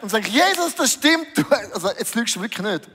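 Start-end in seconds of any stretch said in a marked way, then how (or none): 0.3-1.4 eager agreement. Retold or imitated.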